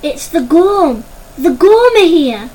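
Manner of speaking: loud, slow, terrified cry; panicked shout. Acted. A lookout announcing the Gorm to the warren, panicked shout. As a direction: scream out the dreaded name. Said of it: "This last shout caused mass panic".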